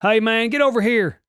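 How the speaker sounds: loud-ish, high Southern cop voice; hammed-up Southern traffic cop; botched Southern accent. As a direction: call someone over in a bad Southern accent. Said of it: "I turned it into a Southern accent".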